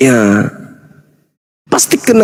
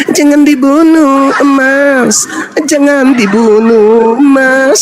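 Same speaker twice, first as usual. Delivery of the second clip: slow, high, drawn-out devil plea; devil's fake plea; 7 semitones higher, much slower than usual. Preacher as devil winking at the executioner, mock pleading. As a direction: beg insincerely, stretching every word. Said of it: "Iblisnya teriak".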